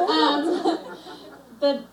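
jittery nervous giggle; nervous laugh. Real, not acted. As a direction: laugh off the nerves. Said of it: "I'm a little nervous".

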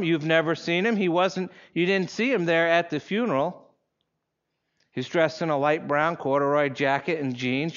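ordinary talk

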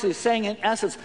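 normal talking voice

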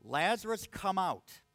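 calling out loudly to a dead man. Acted. Jesus calling Lazarus out of the tomb, loud commanding call. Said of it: "Jesus called in a loud voice".

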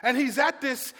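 usual speaking voice

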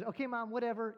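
a fed-up son cutting off his mother; frustrated and angry. Retold or imitated. Son hanging up on mom, a retold angry brush-off. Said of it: "Frustrated and angry".

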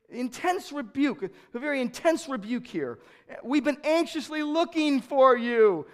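loud, high, pressing sermon voice; forceful preaching. Acted. Preacher voices the anguish of Jesus' parents, loud preaching. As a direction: drive the point home from the pulpit.

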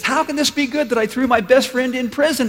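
high, loud acted disbelief; incredulous protest. King questioning his jailed friend's optimism, acted incredulous outburst. King's incredulous retort in story.

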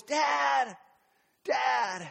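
put-on high, little boy calling out lost. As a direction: call out like a lost small child. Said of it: "I hear his little voice".

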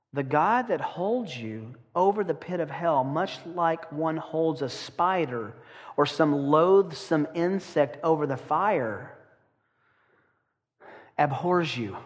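slow, grave reading of a fire-and-brimstone sermon. Shown as a demonstration. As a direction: lean into the dread of each image. Let me read it for you.

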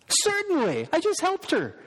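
high, bright imagined reply; eager woman. Pretend. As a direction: play her as warmly eager to answer.

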